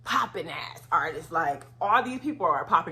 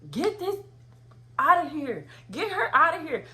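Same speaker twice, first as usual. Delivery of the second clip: higher, louder boss barking an order; angry order; 10 semitones higher, 5 dB louder than usual. Retold or imitated. Label owner ordering staff to remove her, loud and high.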